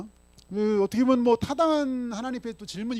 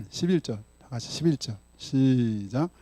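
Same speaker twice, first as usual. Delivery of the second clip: ordinary delivery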